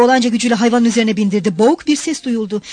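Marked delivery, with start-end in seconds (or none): none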